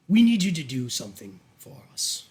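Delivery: lightly played patron giving orders, pausing between phrases; lightly voiced sinister duke. Duke asking Bridget for a favour, lightly commanding. Game master plays the Duke.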